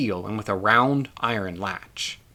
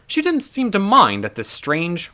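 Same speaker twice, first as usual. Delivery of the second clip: plain reading voice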